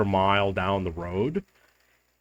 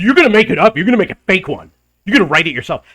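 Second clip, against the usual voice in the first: higher, louder accusing mock yell; mild mock yell; 7 semitones higher, 7 dB louder, much faster than usual. The angry owner accusing him of faking the message, mock yell. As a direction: fling the accusation at him, half-performed. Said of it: "he didn't say it like that, he yelled it".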